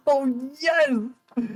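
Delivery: high gleeful cry; triumphant. Real, not acted. Player gleeful when the breakup works, high and triumphant. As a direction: celebrate the win up high.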